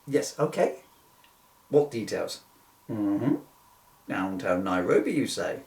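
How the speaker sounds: ordinary delivery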